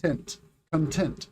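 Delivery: TENT alone, then conTENT; slow "content" as cun-TENT; stress on second syllable. Shown as a demonstration. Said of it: "the second syllable".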